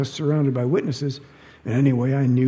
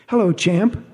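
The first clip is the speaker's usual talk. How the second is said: sugary greeting; 7 semitones higher, 6 dB louder than usual. Acted. Narrator greets ex-husband brightly.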